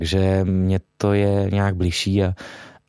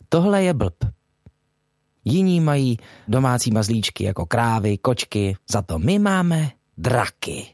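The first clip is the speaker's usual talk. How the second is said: animated hero's opening monologue, pitched up; higher-voiced cartoon lead setting the scene; Škyťák; 3 semitones higher than usual. Shown as a demonstration. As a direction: introduce your village like a film narrator, in measured phrases with pauses. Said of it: "pracovali s tím úvodním monologem".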